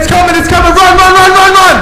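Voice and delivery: high loud frantic yelling; screaming that it's coming; panicked screaming. Real, not acted. Monster approaching.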